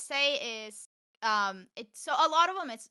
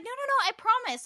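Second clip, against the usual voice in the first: very high pleading; high mock begging of a friend; mocking Yara; 13 semitones higher, 4 dB louder than usual. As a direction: squeak out the promise. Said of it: "She's like".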